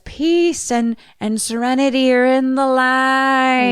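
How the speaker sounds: high, slow, sustained proclamation; drawn-out high summons; retold calling out. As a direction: intone it slowly like a medium. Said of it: "She starts yelling now".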